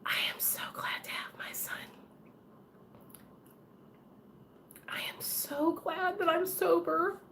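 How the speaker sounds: tearful, broken whisper fighting back tears; choked-up grateful whisper; emotional whisper. Real, not acted. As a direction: whisper through real tears of gratitude. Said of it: "I'm gonna get emotional".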